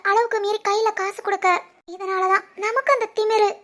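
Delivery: usual speaking voice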